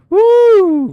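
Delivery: high loud whoop; excited whoop. Real, not acted. Admiring Catwoman photo on stream, high loud whoop. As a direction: let out a delighted woo. Reacting to Julie Newmar photo.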